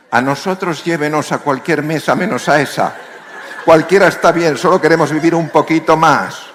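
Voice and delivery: high, loud desperate begging; squealing piglets begging to be spared; imploring minipigs. Retold or imitated. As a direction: plead for your life as a little pig. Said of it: "en actitud implorante".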